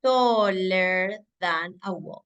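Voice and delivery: slow, careful English phrase; teacher drilling the phrase slowly; slow model phrase. Shown as a demonstration. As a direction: stretch each word for learners. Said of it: "voy a decir".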